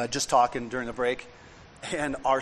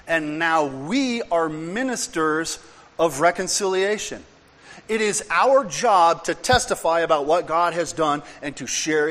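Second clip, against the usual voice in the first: preaching; 3 semitones higher, 5 dB louder than usual. Acted. Preaching scripture.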